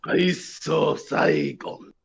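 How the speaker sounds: slow, drawn-out mock Japanese name; lightly put-on Japanese accent. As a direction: intone it like an ancient Japanese curse. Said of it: "imagine saying that with a Japanese accent".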